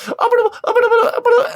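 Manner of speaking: very high squeaky gibberish; imitating Kurt gibberish. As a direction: squeak nonsense like a cartoon character. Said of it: "And Kurt's like".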